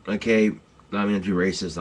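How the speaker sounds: usual speaking voice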